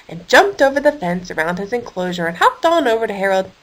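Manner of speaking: plain reading voice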